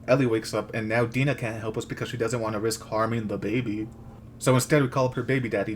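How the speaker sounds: ordinary delivery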